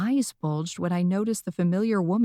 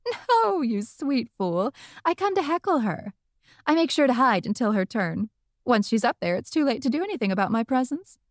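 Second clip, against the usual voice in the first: mocking witch Rosalie; 6 semitones higher than usual. A narrator's reading.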